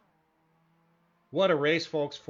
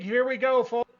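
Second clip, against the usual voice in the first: higher and louder, building hype; excited call; 7 semitones higher, 5 dB louder than usual. Real, not acted. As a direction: call the finish with rising energy. Race finish building up.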